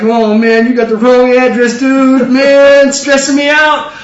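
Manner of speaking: high, drawn-out protest; hammed-up whining. Gideon to the messenger, hammed-up whiny reluctance. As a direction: moan like a stressed-out slacker.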